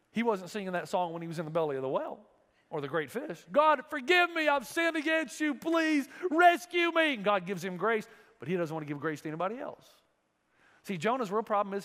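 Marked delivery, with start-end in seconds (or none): 3.5-7.2 loud, high cry for help, slowing into a shouted please rescue me; desperate plea. Acted. Jonah crying out to God from the fish's belly. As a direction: beg for rescue from inside the fish.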